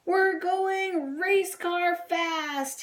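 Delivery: high, very slow exultant cry; bunny excited in flight; thrilled yell. A narrator's reading. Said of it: "he yelled".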